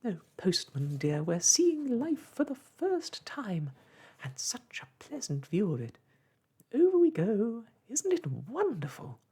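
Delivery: high, loud, thrilled lady's voice; Miss Tartlet rejoicing. A narrator's reading. Miss Tartlet to the postman as they tumble, high and thrilled. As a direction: squeal it out with wonder, pitched high.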